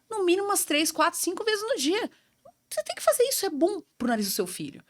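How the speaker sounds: high, preachy lecturing voice; bossy doctor. Retold or imitated. Old self scolding a tired mother, shrill and preachy. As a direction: play the know-it-all doctor nagging a mother. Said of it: "É a louca, né?".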